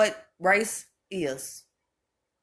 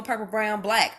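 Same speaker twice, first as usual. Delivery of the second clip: ordinary talk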